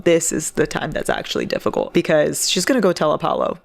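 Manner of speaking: ordinary talk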